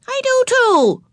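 high, loud insistence; insist, forgetting to whisper. A narrator's reading. Ramona insisting to her sister, high and loud. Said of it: "insisted Ramona".

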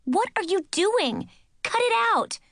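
high, loud indignant yelling; furious, telling her to stop; angry shout. Acted. Girl snapping at her cousin who grabbed her hair, high angry shout.

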